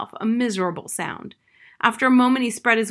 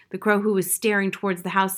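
usual speaking voice

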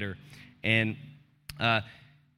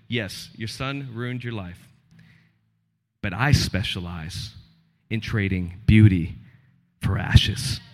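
voice of God; 5 semitones lower, 5 dB louder, slower than usual. Acted. God answers in the story.

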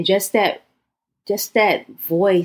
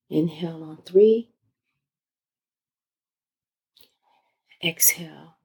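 guided meditation. Acted. Leads guided breathing exercise.

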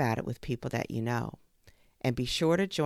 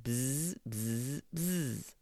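soft, quiet buzzing; quiet insect buzz; fly buzzing; 6 dB quieter, much slower than usual. Acted. Fly can only buzz.

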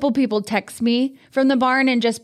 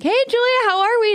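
chirpy neighbor; 10 semitones higher than usual. Retold or imitated. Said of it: "he's like".

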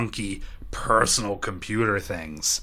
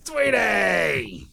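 calling out; 9 semitones higher, 3 dB louder than usual. Real, not acted. Calling out to someone.

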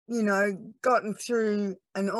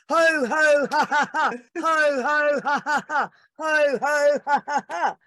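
high, loud chanted laughter yoga ho-ho-ha-ha-ha; imitated ho-ho-ha-ha laughter; 8 semitones higher, 4 dB louder than usual. Villagers greeting the laughter lady, high chanted laughing. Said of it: "people go".

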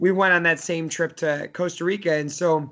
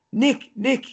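put-on Spanish accent; 8 semitones higher, 3 dB louder than usual. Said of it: "in a Spanish accent".

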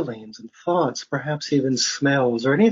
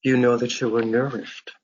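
guided meditation; 3 semitones lower than usual. Real, not acted. Faculty member leading listeners through breathing and imagery, guided meditation. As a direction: guide them into relaxation, phrase by unhurried phrase.

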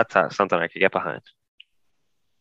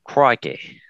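put-on Australian exclamation; Australian accent; 3 semitones higher than usual. Trying a British accent that lands as Australian, mock accent. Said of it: "that's Australian".

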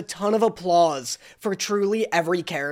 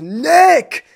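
louder and higher, a mock call across a line; 8 semitones higher, 8 dB louder than usual. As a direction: call his name to get attention. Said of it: "he goes".

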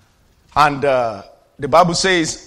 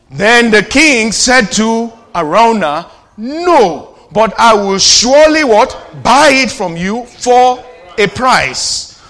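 emphatic slow proclamation of scripture; loud slow scripture reading; 5 semitones higher, 7 dB louder, slower than usual. Acted. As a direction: read it out loud and slow with the church. Congregation reads verse aloud.

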